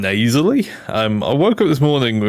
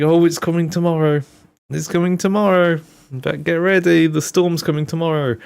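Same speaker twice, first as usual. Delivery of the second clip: higher, lively mock announcement of the storm; imitating hyped people; 8 semitones higher than usual. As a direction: lightly voice people hyping up the coming storm. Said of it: "people have been saying".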